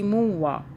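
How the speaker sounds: मुवा with a light noon ghunna before the wa; nasalised joining. Shown as a demonstration. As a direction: hum a slight n sound into मुवा. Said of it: "नून गुनने की आवाज़ आएगी".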